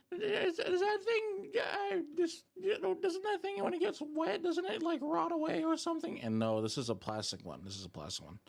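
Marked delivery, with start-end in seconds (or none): 0.1-6.2 squeaky, very high doubting voice; imitated viewers. Streamer mimics chat asking about a soggy stick, squeaky mock voice. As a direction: question it haltingly in a tiny high voice. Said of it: "You guys are like".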